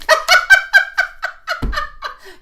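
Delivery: bright high laugh; high giggle. Real, not acted. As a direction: burst into a giggle. Flattered by comment.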